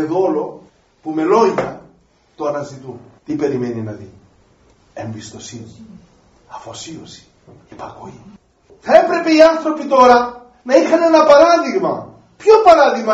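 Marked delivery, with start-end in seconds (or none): 4.9-8.2 softer, whispered, one word at a time; whispered list. Real, not acted. Preacher whispers what God waits to see from the faithful. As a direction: breathe each virtue out in a whisper.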